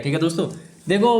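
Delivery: ordinary delivery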